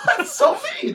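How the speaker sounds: high, slow, laughing words; real laughter breaking into the words; laughing protest. Real, not acted. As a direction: giggle through a playful scolding. Laughing at co-host calling guest boy.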